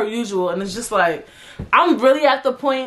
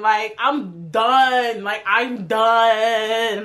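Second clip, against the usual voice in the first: drawn-out exhaustion; 3 semitones lower, much slower than usual. Real, not acted. Fed up with covid, dragging.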